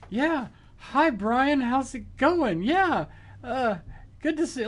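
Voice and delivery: squeaky starstruck hi; overexcited greeting. Retold or imitated. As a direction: gush nervously at your hero. Nervous fanboy greeting Brian.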